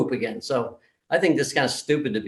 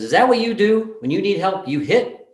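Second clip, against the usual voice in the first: stern rebuke; 6 semitones higher than usual. Retold or imitated. Therapist rebukes the slapper.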